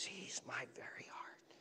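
hushed whisper of tender love; God whispering. Acted. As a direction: breathe it like a secret from heaven. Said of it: "and God says".

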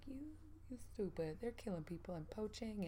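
a whispered, half-laughing scold; mock scolding whisper. Grown-up scolding her childhood self in a whisper, half-laughing. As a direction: hiss it at her younger self, amused and appalled. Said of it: "I look back like".

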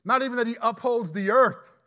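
ordinary delivery

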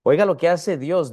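usual speaking voice

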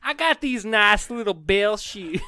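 slow, high southern drawl; Phantom Bell man. Pretend. Ghost bellman proud of his shoes, in a slow southern drawl. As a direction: proudly drawl it in a put-on Texas accent. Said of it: "He speaks like a southern debonair".

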